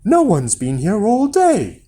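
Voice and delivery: put-on giant's wife covering for a hidden boy. A narrator's reading. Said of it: "said his wife".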